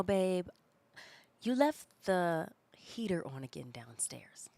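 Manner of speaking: hushed, slow scolding reminder; quiet, slow nagging tone; imitating husband. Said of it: "I'm not gonna do the voice".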